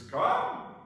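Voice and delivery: lightly acted summons across the water; lightly voiced Jesus. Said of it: "And Jesus says".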